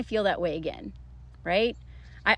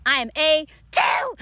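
louder, higher boast, each word punched; triumphant shout; 5 semitones higher, 4 dB louder than usual. Real, not acted. Student bragging about passing French test, triumphant shout. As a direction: crow it proudly.

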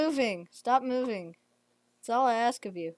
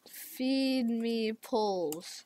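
slow; 7 dB quieter, much slower than usual. Real, not acted. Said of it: "That's my name".

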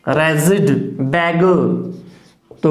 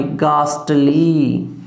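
Ghostly drawn out very slowly, sound by sound; slow and careful, every sound of ghostly; slow spelled-out pronunciation; 5 semitones lower, much slower than usual. Shown as a demonstration. Teacher models the word slowly for students. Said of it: "गै plus आ plus से".

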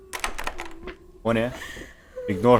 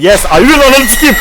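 high, loud cursing yell; panicked shout; 12 semitones higher, 12 dB louder than usual. Real, not acted. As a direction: scream the curse while fleeing. Fleeing a monster in the game.

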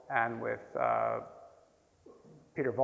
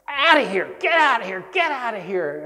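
high loud shout that steps down each time; retold shout, fading; 8 semitones higher, 8 dB louder than usual. Teacher chases the stranger out of class, loud then fading. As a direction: throw him out, then let it wind down.

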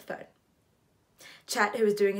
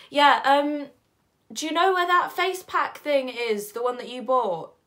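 raised, higher voice to carry; calling through door; 7 semitones higher, 9 dB louder than usual. Acted. Narrator asking partner for the face pack, calling loudly. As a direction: call out to someone in the next room.

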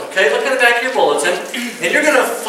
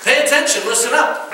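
higher-pitched playful nag to the spouse; mock spouse scolding; 5 semitones higher than usual. Spouse telling the other to pay attention, mock nag. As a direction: tease like a nagging husband. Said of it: "to your wife or your husband?".

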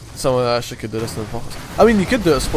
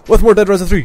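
excited intro; 7 semitones higher, 8 dB louder than usual. Real, not acted. Energetic let's play intro.

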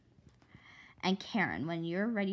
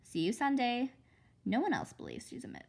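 ordinary delivery

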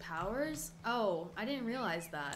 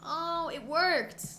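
excited; 7 semitones higher, 5 dB louder, much slower than usual. Real, not acted. Game action succeeds.

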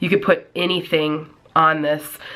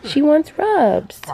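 drawn out high coo on behalf of the dog; 9 semitones higher, 4 dB louder, much slower than usual. Real, not acted. Speaking for the dog.

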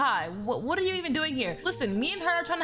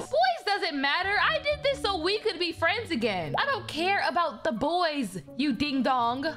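ordinary delivery

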